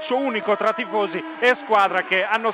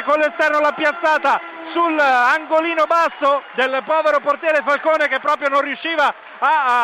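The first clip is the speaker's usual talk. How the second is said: breathless italian goal commentary; 7 semitones higher, 4 dB louder than usual. Real, not acted. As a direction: scream the goal and keep it up. Second goal called live. (urlo del gol, telecronaca senza fiato)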